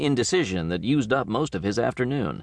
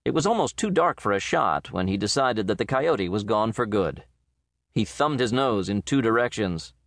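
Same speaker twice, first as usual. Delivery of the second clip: ordinary delivery, nothing marked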